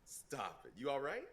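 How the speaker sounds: high, loud incredulous squeal; startled by the politeness; shocked disbelief. Retold or imitated. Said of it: "I was like".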